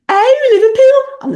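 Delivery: high, loud Scouse exclamation; Scouse accent. Retold or imitated. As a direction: imitate a friend's excited Scouse outburst. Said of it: "riffing in Scouse".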